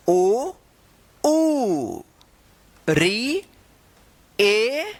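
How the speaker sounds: teacher chanting vowels one by one; slow classroom vowel recital; reciting vowels. Shown as a demonstration. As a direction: call out each vowel clearly for the class. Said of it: "एक बार और repeat कर देते हैं".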